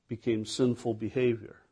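ordinary talk

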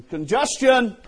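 God yelling a warning to the stubborn driver; urgent warning shout. Retold or imitated. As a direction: shout it to stop someone in their tracks. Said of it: "and God's going".

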